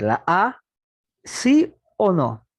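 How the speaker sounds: ordinary delivery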